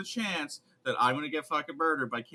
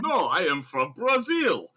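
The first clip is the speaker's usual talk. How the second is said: hammy fake Russian accent; mock Russian accent; 5 semitones higher, 4 dB louder than usual. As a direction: insist like a bad spy. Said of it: "a Russian accent".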